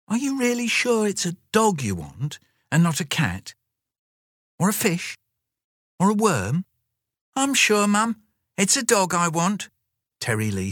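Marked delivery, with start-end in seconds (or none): 0.1-6.6 high, fussing mother; higher mother voice asking anxiously; Mum's voice. Acted. Mum character voice in story. 7.3-9.7 put-on high, eager child; much higher, sure little girl; little girl's voice. Child Terry Lee voiced.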